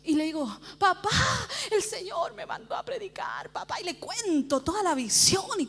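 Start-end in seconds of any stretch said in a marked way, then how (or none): 0.8-3.8 soft, high appeal; tentative pleading. Retold or imitated. Preacher breaking the news to her father, pleading. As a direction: plead softly, voice raised.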